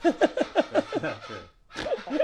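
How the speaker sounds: laughing. Real, not acted. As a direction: protest through laughter, high and slow. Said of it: "You can hear [name] laughing".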